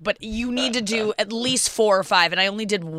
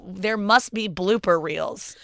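ordinary talk, nothing marked

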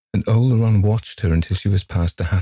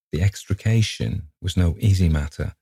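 ordinary delivery, nothing marked

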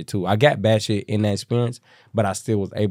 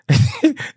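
high breathy laugh; 4 dB louder than usual. Real, not acted. Laughing at the clip.

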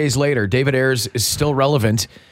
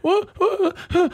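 flustered daughter. Retold or imitated. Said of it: "And she's like".

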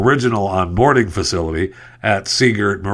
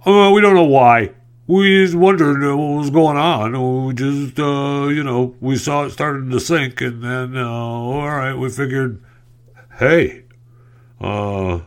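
higher, then fading mock official voice; mock clueless officials; 4 semitones higher than usual. Host mimicking clueless officials about the sinking ship, mocking. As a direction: play the baffled officials, dim. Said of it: "acted officials' statement".

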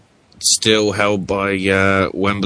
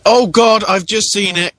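sudden loud startled exclamation; surprised; 8 semitones higher, 5 dB louder, slower than usual. Real, not acted. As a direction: blurt it out startled. Sudden spotting on screen.

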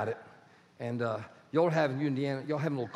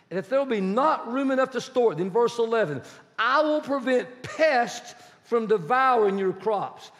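high, loud scripture proclamation; fervent preaching; 7 semitones higher, 6 dB louder than usual. Acted. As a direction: preach it out to the congregation.

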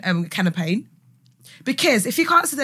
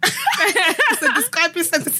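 laughing bluff; 7 semitones higher, slower than usual. Pretend. Bluffing a fake definition.

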